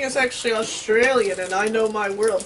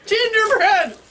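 louder and higher correction; correcting shout; 10 semitones higher, 10 dB louder, slower than usual. Real, not acted. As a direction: shout the right word. Correcting the mishearing.

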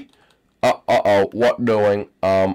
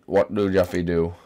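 normal talking voice

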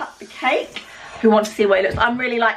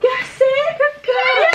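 excited praise; 14 semitones higher, much slower than usual. Real, not acted. Praising child after candles.

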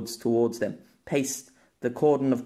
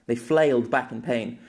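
ordinary delivery, nothing marked

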